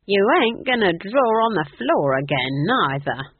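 put-on slow, loud old-witch warning; firm, slow telling-off. A narrator's reading. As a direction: warn her off firmly, drawing it out. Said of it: "warned Nanny Ogg".

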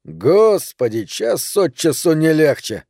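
slow, exhausted groan of words; weary. Acted. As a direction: drag it out, fed up. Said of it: "Тони измученно вздохнул".

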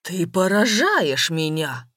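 slow, forceful man's outburst; angry yell. A narrator's reading. Ruined businessman rebukes his beloved, angry yell. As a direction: shout it, stretching each word. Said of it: "закричал разорившийся бизнесмен".

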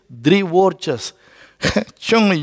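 ordinary talk

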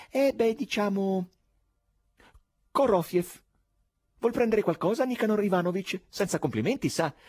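high, loud, oily cordial stranger voice with laughs; cordial exclamation. Acted. Korofiev fobs off the chairman, high cordial voice with chuckles. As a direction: gush over him like a slippery charmer.

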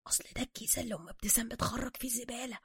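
very soft, breath-only excuse; whisper. A narrator's reading. Suka explaining the bag to Bruce, whispering. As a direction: whisper it sheepishly in slang. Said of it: "سوكا هامسا".

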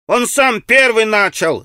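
put-on high, drawn-out aggrieved boyish cry; irritated child's voice. A narrator's reading. Boy in red shirt blaming the other, irritated cry. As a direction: protest like a cross little boy. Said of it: "закричал мальчик в красной рубашке раздражённым детским голоском".

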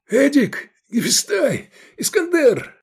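high, urgent calls; shouting names. A narrator's reading. Escaping man looks for his comrades, shouting. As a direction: call each name out high across a distance. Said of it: "крикнул Лобанов".

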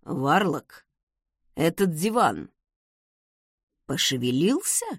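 low, halting, uneasy question; strange voice. A narrator's reading. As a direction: ask oddly, low and in broken pieces. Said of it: "спросил странным голосом".

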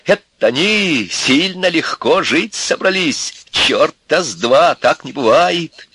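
high, slow, emphatic indignation; flaring up. A narrator's reading. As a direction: rail against them, drawing words out. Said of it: "взвился опять отец". (взвился, возмущённо и протяжно)

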